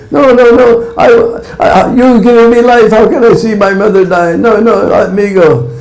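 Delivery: put-on high, childlike pleading; pleading young calf. Calf begging its mother to stay, in a high pleading voice. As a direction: beg like a small calf desperate to save its mother. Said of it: "So they were arguing like this".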